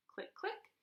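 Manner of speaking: high whispered click click; camera clicks. Acted. Narrator imitating the camera clicking.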